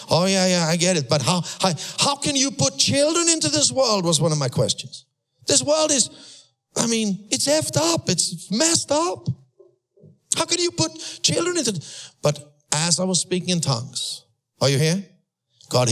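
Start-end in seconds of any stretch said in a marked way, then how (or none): 0.1-4.0 exasperated high question. Retold or imitated. His own past question retold. 5.5-11.8 high exasperated outburst. Real, not acted. Preacher's rising outrage at world.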